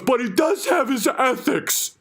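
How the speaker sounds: high slow mocking voice; mock defender. Fans defending the bear, high slow mocking voice. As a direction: drag it out like a naive fan. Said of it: "everyone goes".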